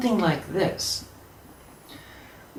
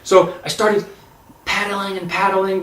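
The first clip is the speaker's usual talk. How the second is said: plain reading voice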